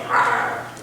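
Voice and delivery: a low mock growl from the demons. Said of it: "and they all growl back at him".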